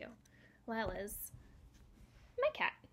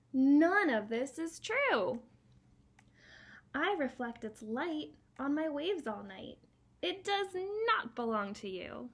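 ocean character voice; 4 semitones higher, 6 dB louder, slower than usual. Acted. Ocean voiced in picture book.

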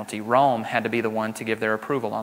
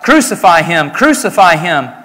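loud cry, repeated; shouting crowd; 5 semitones higher, 10 dB louder than usual. Acted. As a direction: yell it as the priests demanding death. Chief priests cry out.

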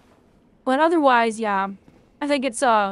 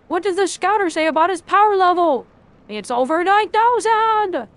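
Vegeta meme; 5 semitones higher, 4 dB louder than usual. Retold or imitated. Streamer shouting the over nine thousand meme at chat. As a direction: yell it like the anime meme. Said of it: "the Vegeta!".

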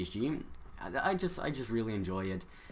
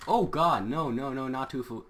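startled panic; 4 semitones higher, 6 dB louder than usual. Real, not acted. Sudden threat in game.

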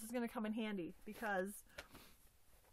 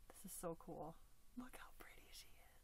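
soft rushed whisper; 7 semitones lower, 15 dB quieter than usual. Real, not acted. Admiring the finished doll, rushed whisper. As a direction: hush it out in delight.

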